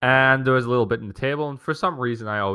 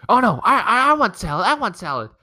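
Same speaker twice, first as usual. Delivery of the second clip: much higher and louder, pleading whine; whiny sister; 12 semitones higher, 6 dB louder than usual. Retold or imitated. His sister suddenly claiming the salad, high and whiny. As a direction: whine for it like a spoiled kid.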